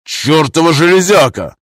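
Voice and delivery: loud, high furious yell; angry shout. Acted. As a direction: curse the machine loudly. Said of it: "снова заорал Орсон".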